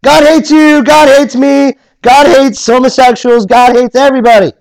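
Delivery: high, loud ranting street preacher; mock hate-preacher yelling at the crowd; Fred Phelps rant. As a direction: bellow it like a fire-and-brimstone zealot. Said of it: "like the Fred Phelps of the world".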